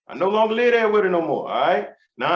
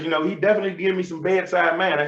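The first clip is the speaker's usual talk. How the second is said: usual speaking voice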